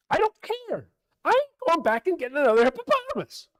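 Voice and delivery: high, very loud comic tirade; yelling at God. Retold or imitated. Noah refuses God's order, comic yelling. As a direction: refuse at the top of your voice. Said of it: "He's yelling at God".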